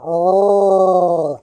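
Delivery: long, loud, high "ohhh"; imitated knockdown roar. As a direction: draw out a rising-pitched crowd-like oh. Imitates crowd or reaction at knockdown.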